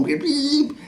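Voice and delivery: high drawn-out "beep"; imitated car horn. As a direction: honk like a car horn with your voice. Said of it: "used to be bibbing me on".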